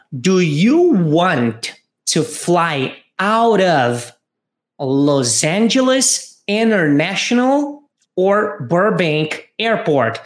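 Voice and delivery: slow, careful question read; drawn-out model line for repetition. Shown as a demonstration. Teacher reading the agent's question slowly to students. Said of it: "o atendente pergunta o seguinte".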